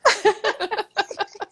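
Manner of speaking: a bright, high burst of laughter. Real, not acted. Host laughing with her cohost about pulling up a chair. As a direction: let a happy laugh ring out.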